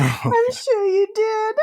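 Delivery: high, slow words through laughter; laughing reply. Real, not acted. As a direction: laugh helplessly while answering. Amused reply after the loud impression.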